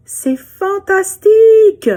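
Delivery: put-on high, slow, loud child's cry of wonder; excited shout. Little girl shouting her wonder at the mountains. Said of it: "elle se met à crier".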